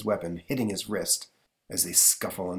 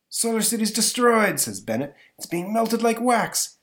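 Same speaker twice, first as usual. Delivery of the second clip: put-on higher, louder dismayed old professor; alarmed professor; 12 semitones higher, 5 dB louder than usual. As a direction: cry out in horror at the ruin. Bennett voiced alarmed.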